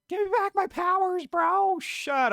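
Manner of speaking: very high whiny plea for powers; squeaky bratty witch demanding. Pretend. Voicing Agatha whining.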